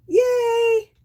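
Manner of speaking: high squealing yay; excited cheer. Real, not acted. Cheering more bubbles.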